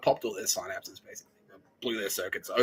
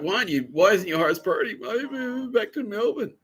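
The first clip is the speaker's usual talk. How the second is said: high, whining complaint; mock whiny friends; 11 semitones higher, 5 dB louder than usual. The hurt friends whining about the move, high. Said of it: "they got really butthurt".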